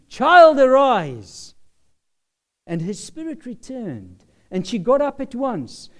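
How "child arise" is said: loud, raised-pitch command; ringing call to rise, high and loud. Acted. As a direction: call it out with sudden full force. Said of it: "he called, saying".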